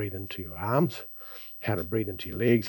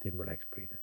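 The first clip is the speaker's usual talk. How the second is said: soft, calm breathing instruction; guided breathing; 3 dB quieter than usual. Acted. Guides breathing exercise, soft and slow.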